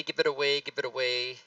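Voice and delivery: ordinary talk, nothing marked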